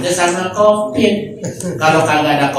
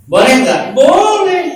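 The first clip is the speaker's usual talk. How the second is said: mock call and answer; 8 semitones higher, 3 dB louder than usual. Mock asking and answering the crowd.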